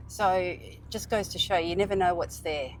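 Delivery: ordinary talk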